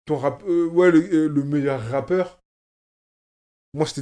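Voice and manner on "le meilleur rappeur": ordinary talk, nothing marked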